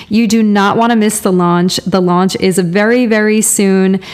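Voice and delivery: bright merch plug, pushing the sign-up; ad read. Acted. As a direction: sell the hoodies like a radio ad. Host reads sponsor merch ad.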